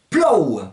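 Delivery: PLOU as one open, gliding o-ou syllable; Provençal pronunciation. Shown as a demonstration. Teacher translating "il pleut", Provençal pronunciation. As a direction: model plou with a falling Provençal diphthong. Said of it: "ça va donner il pleut".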